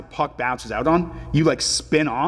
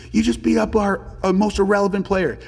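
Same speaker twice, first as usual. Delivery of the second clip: higher-pitched mock heckle from a coach; imitated chirping; 7 semitones higher than usual. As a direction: imitate an indignant rival coach. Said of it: "chirping me".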